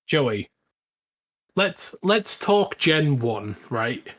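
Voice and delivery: ordinary talk, nothing marked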